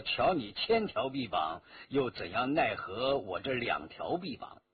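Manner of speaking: low, mildly mocking challenge; sarcastic. A narrator's reading. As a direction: taunt with understated sarcasm. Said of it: "他意含讥讽". (略带讥讽)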